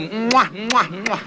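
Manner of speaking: mock kissing noises in a row; imitated kisses. Wife kissing husband's hand at Lebaran, imitated smacks.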